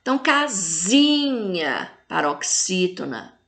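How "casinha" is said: "casinha" with a long, drawn-out ZI; teacher's slow stress on ZI in "casinha"; tonic syllable stressed. Shown as a demonstration. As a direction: stretch the middle of "casinha" slowly. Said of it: "fala com mais intensidade".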